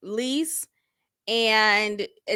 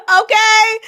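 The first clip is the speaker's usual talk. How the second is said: ordinary talk, nothing marked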